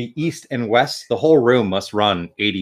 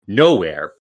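usual speaking voice

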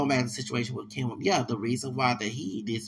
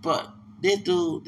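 normal talking voice

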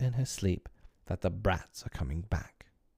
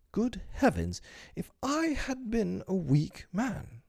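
much higher, slow mock-pompous exclamation; self-admiring exclaim; 12 semitones higher than usual. Mr Darling grandly marvelling at his own virtue. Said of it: "he said".

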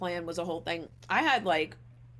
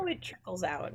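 ordinary talk

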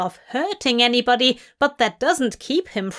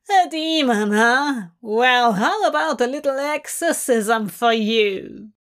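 menacing taunt. Acted. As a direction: sneer the threat. Villain taunting a demon.

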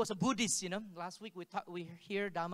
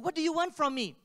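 higher and louder, pressing for an answer; loud demanding question; 8 semitones higher, 8 dB louder than usual. Retold or imitated. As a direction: demand it of the figure in the dream. Pleading with the dream figure.

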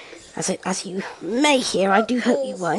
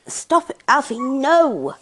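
firm telling off; 5 semitones higher, 5 dB louder, slower than usual. Real, not acted. Scolding baby.

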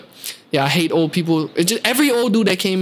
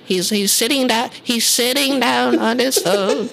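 lightly higher, slower mock old lady complaining; 6 semitones higher, slower than usual. Worker mocks the old lady calling management, mild mock voice. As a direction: mimic a prim old customer snitching. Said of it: "and saying".

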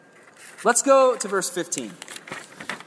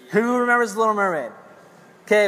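ordinary talk

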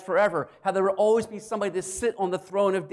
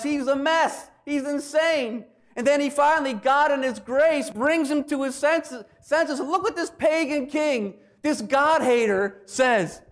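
raised, emphatic storytelling from the pulpit; impassioned preaching; 7 semitones higher, 4 dB louder than usual. Real, not acted. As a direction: build toward the big quote with raised pitch. Preaches with mounting force.

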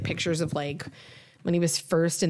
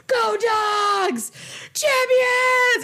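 very high, louder shouted cheer; imitated screaming; 6 dB louder, much slower than usual. Retelling Alex shouting outside after the win, high loud shout. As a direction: bellow it to the whole neighborhood. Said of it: "screaming to the neighborhood".

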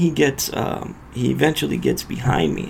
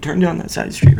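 repeated louder; 5 semitones higher, 5 dB louder than usual. Retold or imitated. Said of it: "So I tell him".

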